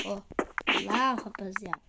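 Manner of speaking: low, soft mock-streamer wow; hushed, low impression of a streamer; Lucão Meno. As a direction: lightly imitate a gamer showing off, voice dropped. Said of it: "Tô imitando o Lucão Meno".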